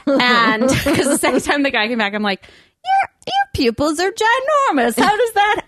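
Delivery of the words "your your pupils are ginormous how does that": high-pitched, incredulous retelling; mock astonishment. Customer confronting a waiter about his huge pupils, mock astonished. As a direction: draw it out high in mock amazement.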